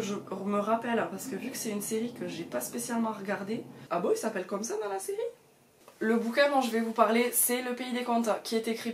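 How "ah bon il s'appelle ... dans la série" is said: quick, high, taken aback; surprised. Real, not acted. Checking the book, surprised by the name. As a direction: caught off guard by a name.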